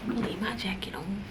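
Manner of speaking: soft whisper, no voice. Real, not acted. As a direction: whisper it so nobody hears. Whispering in gym locker room.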